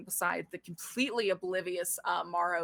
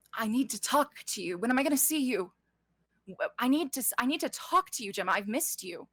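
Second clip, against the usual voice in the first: higher, earnest plea; urgent pleading; 5 semitones higher, 3 dB louder than usual. Acted. Ystira pleads with Gemma, urgent and higher. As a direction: plead with someone you have missed.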